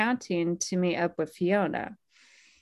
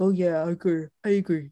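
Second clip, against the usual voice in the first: dopey voice; 2 semitones lower, much faster than usual. Retold or imitated. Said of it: "in this, like, dopey voice".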